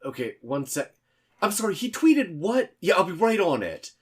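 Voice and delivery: very high, loud, panicked aside; frantic multitasking. Acted. The intern reacts in panic to another call mid-request, frantic. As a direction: sound swamped and alarmed.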